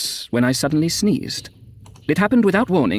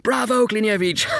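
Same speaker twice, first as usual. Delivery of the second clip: high-pitched cheer; roaring approval; 10 semitones higher than usual. A narrator's reading. The dead applauding Klinevich, roaring cheer. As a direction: cheer him on from the crowd. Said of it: "The voices roared".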